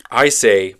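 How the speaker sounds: ordinary talk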